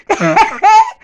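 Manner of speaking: high, loud burst of laughter; gleeful cackle. Acted. Woman laughing to Jenny at the peeping neighbour, high cackle.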